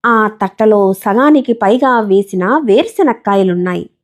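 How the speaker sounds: usual speaking voice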